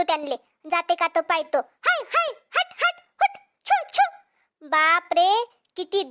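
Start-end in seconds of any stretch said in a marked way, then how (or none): 1.9-4.1 high calls, higher each time; shooing shout. Acted. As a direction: scare the monkeys off. Shooing monkeys once more.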